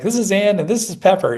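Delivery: high, loud, sing-out introductions; retold eager introduction. Retold introductions to break the tension.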